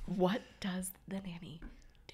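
hushed, voiceless whisper; conspiratorial whisper. Pretend. Host slips in joke about the nanny, playful whisper. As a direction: whisper it like a secret question.

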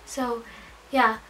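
ordinary talk, nothing marked

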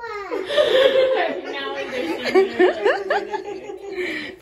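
long, high burst of laughter; real giggling fit. Real, not acted. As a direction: laugh helplessly, pitched high. Vlogger laughing as kids wake.

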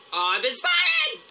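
high, slow alarmed cry; startled yelp at being seen. Real, not acted. Spotted by enemies.

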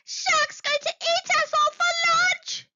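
high, loud panicked cry; cried. Acted. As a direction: shriek the warning to the others. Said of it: "Jellyfish cried".

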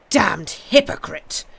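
loud, slow denunciation; angry cry. A narrator's reading. Intruder denouncing Jarvie, loud angry cry. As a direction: hurl it out with contempt. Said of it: "cried the intruder".

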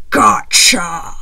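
low, loud whispered growl; dinosaur yell. A narrator's reading. Dinosaur pouncing on the girl, loud low snarl. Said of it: "a dinosaur yelling".

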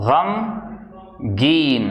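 गमगीन stretched out slowly, every sound held; slow drawn-out word. Shown as a demonstration. Teacher slowly modelling गमगीन for students. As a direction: draw out गमगीन slowly for learners.

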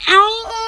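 a short pained "ow" with a wobble; retold yelp of pain. Telling viewers about falling by the pool, a pained "ow". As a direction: relive the sting of the fall. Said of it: "I was like".

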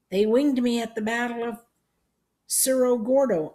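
put-on high, loud old-man voice; old veteran. A narrator's reading. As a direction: proudly recount it as an aged soldier. Old veteran recalls his battle.

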